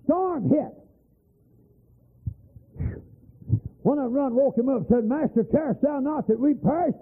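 high, building preacher's chant; preaching storm scene. Acted. Preacher telling congregation of the storm, rising chant. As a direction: paint the storm as you preach.

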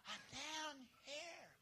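tiny, high, drawn-out voice; weak falsetto call from underneath; feeble answer. Acted. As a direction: answer softly, with hardly any voice. Fallen man answers with no voice.

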